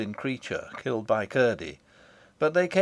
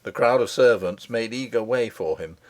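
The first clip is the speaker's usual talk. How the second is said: plain reading voice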